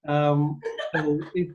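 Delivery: normal talking voice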